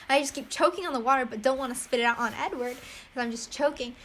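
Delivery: usual speaking voice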